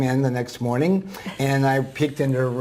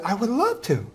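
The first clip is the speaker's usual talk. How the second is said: put-on high frail old lady, delighted giggle; old woman voice, laughing; 10 semitones higher than usual. Guest imitates the delighted old student, high and giggly. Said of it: "I'm gonna use a voice that s-, sounds like her".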